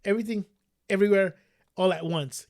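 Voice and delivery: careful, word-by-word title; "everything", pause, "everywhere", pause, "all at once"; slow down through a tongue twister; said slowly. Real, not acted. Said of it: "Gotta say that slow".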